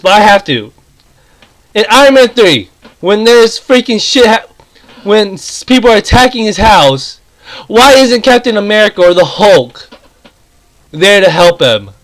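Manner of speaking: ordinary talk, nothing marked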